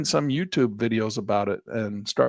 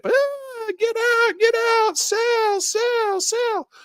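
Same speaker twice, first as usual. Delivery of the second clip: much higher, squeaky cries of get out and sell; mock panic, high and squealing; panicked retail traders; 4 dB louder, much slower than usual. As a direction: play a frantic little trader bailing out. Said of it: "all the little retail traders in there jumping ship".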